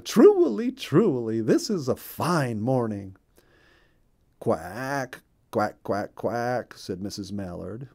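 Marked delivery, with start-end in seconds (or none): 0.0-3.1 a touch of a high, chirpy bird on the first word; bright little bird voice, lightly done; lightly voiced cheerful bluebird. Bluebird greets Missus Mallard cheerfully. 4.4-6.7 spoken quack quack, lightly duck-like; friendly duck quacks. Acted. Missus Mallard quacks glad greeting to Bluebird. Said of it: "her way of saying how glad".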